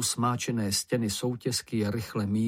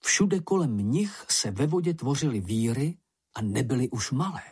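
ordinary delivery, nothing marked

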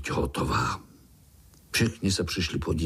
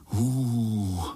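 drawn-out spooked hoo; hooting chorus. A narrator's reading. Little spiders respond to scary tale, hooting. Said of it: "zahučeli pavoučci sborem".

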